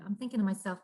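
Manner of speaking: normal talking voice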